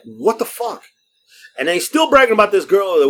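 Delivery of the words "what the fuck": high, stunned disbelief; higher incredulous curse; shocked what-the-fuck. Retold or imitated. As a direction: react as if you can't believe it. Reacts to seven kids.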